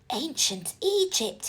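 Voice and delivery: hushed, breathy wonder; awed gasp. A narrator's reading. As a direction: breathe it in amazement. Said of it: "he gasped".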